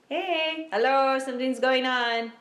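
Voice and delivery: attention-getting call. Pretend. Voices symptoms trying to get attention.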